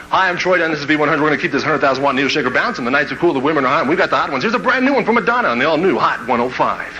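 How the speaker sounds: hyped-up DJ patter, running straight through; cut-loose radio DJ. Acted. DJ finally allowed to cut loose introduces [name], hyped patter. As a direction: let loose on air and build to the song intro. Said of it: "you can just cut loose".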